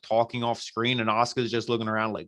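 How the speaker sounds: usual speaking voice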